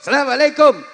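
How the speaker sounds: high, loud cheery salam. Shown as a demonstration. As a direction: shout it up to the headmistress.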